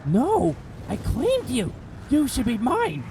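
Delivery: high, theatrical boss taunt; villain boss voice. Pretend. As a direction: ham it up like a jealous goddess. Mocking Garuda's boss lines.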